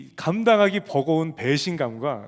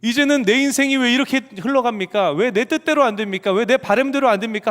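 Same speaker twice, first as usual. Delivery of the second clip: loud, higher whining grievance; complaining to God; 6 semitones higher, 6 dB louder than usual. Retold or imitated. Believer grumbling at God, loud and resentful. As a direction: imitate a sulky complaint. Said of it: "불평하고 원망하잖아".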